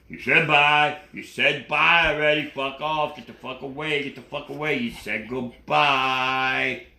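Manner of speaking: very low, loud, angry bully voice; bully shouting. Acted. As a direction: bark it at her like a mean classmate. Samuel cursing at Gail.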